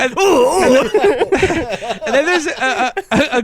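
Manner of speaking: high, very slow laughing shout; cracking up after the joke. Real, not acted. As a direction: laugh through the words.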